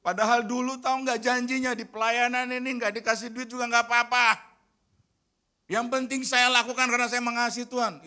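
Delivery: loud, high, fast-paced grumbling at his wife; scolding rant. Retold or imitated. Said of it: "sambil saya ngomel-ngomel loh". (ngomel-ngomel keras)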